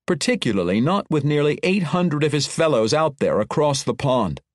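normal talking voice

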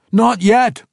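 high, loud desperate yell. Acted. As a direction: cry out to stop them. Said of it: "all yelled together".

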